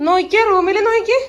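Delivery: loud, high and eager, pointing things out; excited calling out. Real, not acted. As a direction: call them over to look, thrilled. Excitedly showing the room. (ആവേശത്തോടെ ഉറക്കെ വിളിച്ചു കാണിക്കുന്നു)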